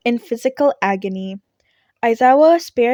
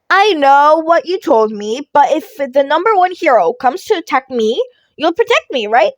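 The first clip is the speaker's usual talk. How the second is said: put-on high, loud wheedling kid voice; teasing Midoriya; 4 semitones higher, 6 dB louder than usual. Midoriya wheedles his teacher into protecting him, teasing.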